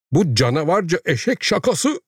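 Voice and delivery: high-pitched, indignant exclamation; outraged cry. A narrator's reading. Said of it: "diye haykırdı müdür". (öfkeyle, tiz sesle haykırarak)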